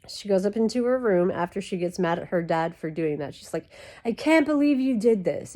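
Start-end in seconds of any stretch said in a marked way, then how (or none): 4.0-5.5 imitated teen complaining at dad, higher pitch; mock teenage outrage, pitched up; typical teenager. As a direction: play an upset teenage daughter. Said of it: "A typical teenager".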